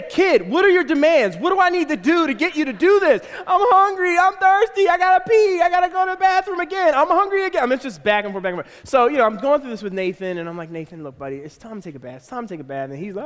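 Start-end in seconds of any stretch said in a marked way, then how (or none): 0.0-3.3 high, loud mock negotiator; hostage negotiator plea. Acts exasperated hostage negotiator. 3.5-7.6 high, loud mock toddler wail; whining three-year-old. Voices whining three-year-old's demands. 10.7-12.9 low, soft parental coaxing; patient coaxing dad. Retold or imitated. Coaxing his son at bath time.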